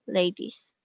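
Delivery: ordinary delivery, nothing marked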